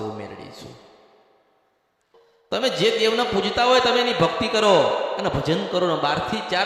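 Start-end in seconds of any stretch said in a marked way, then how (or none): 0.0-0.8 deep, hushed voice of the goddess Meldi announcing herself; a possessed voice naming itself, very quiet. Pretend. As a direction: speak low and soft as the deity revealing herself. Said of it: "પેલી મા બોલવા આવશે".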